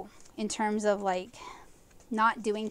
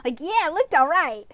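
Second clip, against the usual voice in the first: excited; 5 semitones higher, 6 dB louder than usual. Real, not acted. Proud of finished painting.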